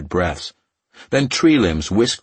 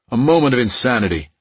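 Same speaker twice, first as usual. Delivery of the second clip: scornful; 5 semitones higher, 4 dB louder, faster than usual. Acted. Dismissive retort.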